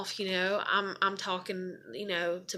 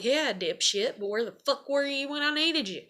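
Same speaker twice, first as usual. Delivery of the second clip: mock retort, climbing higher as it goes; retold indignant thought; 6 semitones higher than usual. Daughter silently to complaining dad, indignant. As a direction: throw it back at him, indignant.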